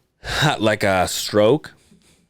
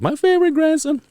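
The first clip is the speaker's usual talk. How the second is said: much higher, a sing-song doting granny; mock gushing grandma, pitched way up. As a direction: coo it like a grandma showing off her favorite. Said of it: "she does says it".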